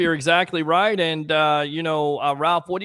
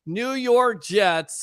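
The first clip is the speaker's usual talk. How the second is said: much higher, very slow, drawn-out mock proclamation; hammed-up, stretched out like a grand announcement; mock Aaron Rodgers; 8 semitones higher, much slower than usual. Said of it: "goes on the Pat McAfee show and says".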